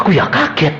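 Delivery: normal talking voice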